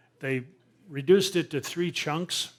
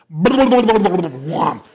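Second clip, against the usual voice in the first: a big loud whomp, pitched up; 6 semitones higher, 17 dB louder than usual. Retold or imitated. Boat hitting the cable, loud whomp. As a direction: slam out the impact noise.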